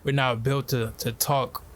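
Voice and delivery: ordinary delivery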